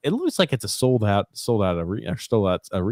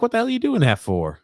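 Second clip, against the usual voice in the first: high squeaky indignant voice; imitating a shocked fan, pitched way up; mock outraged squeal; 13 semitones higher than usual. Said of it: "He's like".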